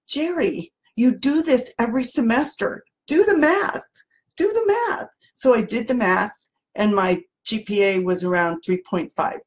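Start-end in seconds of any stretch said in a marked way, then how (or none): 0.1-5.1 high, rising voice of a classmate talking sense; classmate's voice. Retold or imitated. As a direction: pitch it up high to snap her out of the panic. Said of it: "one of my classmates says to me".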